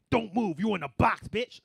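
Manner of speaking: loud mock yell at a mime in a box. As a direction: bark it like a mock threat. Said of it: "I'd probably be like".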